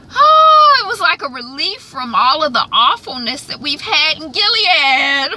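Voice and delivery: high, loud burst of relief; relieved gush. Real, not acted. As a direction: let the relief pour out high and bright. Relief after a bleak series.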